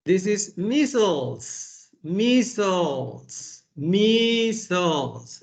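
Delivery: measles repeated slowly and clearly; slow drilled measles. Shown as a demonstration. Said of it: "teacher drills word".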